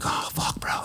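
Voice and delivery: hushed worried swearing; whispered curse. Retold or imitated. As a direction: whisper it so the guy can't hear. Inmate messing with him already.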